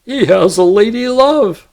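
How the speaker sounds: high, loud mock of a refined accent; mocking mimicry. A narrator's reading. Little John mimicking the stranger's refined accent, high and loud. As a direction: ham up the dandy's fine manner. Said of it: "Little John mimicked his accent".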